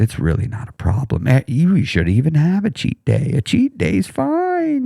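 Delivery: slow, coaxing, sugary temptation; the inner tempter sweet-talking a tired dieter; tempting voice. Retold or imitated. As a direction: seduce them into the cheat day. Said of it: "So it tempts you".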